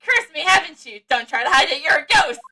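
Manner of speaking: loud accusing character. Acted. Character accuses player of haunting.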